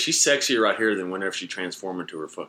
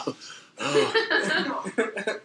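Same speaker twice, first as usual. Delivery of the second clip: mock moan; 12 semitones higher than usual. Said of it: "He's like".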